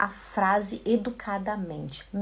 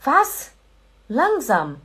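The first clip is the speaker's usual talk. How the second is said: rude reply; 4 dB louder, slower than usual. Retold or imitated. Teacher mimics a rude learner, a loud blunt Was. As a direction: bark it out rudely. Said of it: "isso é muito feio".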